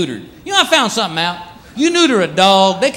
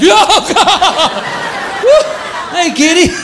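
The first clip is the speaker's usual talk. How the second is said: high startled yelps, then a sheepish hello; 10 semitones higher, much slower than usual. Acted. Half-asleep man finds cat on the toilet, startled yelp.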